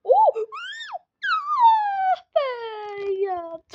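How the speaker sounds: high anguished yell; frustrated cry. Real, not acted. Dies in game.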